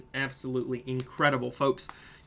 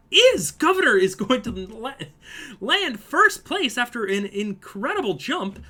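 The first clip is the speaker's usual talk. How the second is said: much higher and louder, triumphant call; excited shout; 8 semitones higher, 9 dB louder, slower than usual. Real, not acted. Commentator announcing the winner's jump, shouted with excitement. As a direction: yell it like a photo finish. Leader lands first place.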